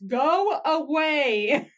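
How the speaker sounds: loud, drawn-out exasperated yell; all-caps shout. Retold or imitated. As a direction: stretch each word out in a mock-furious shout. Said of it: "I put in all caps".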